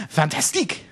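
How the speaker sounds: hammed-up French accent. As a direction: exclaim it like a comic Frenchman. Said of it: "do this in a French accent".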